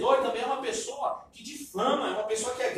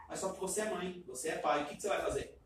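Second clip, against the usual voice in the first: ordinary delivery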